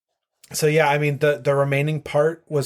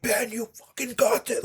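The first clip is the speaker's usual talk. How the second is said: retold scream; 13 semitones higher, 3 dB quieter than usual. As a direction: imitate a screaming fan, pitched way up. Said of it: "two hundred yards screaming".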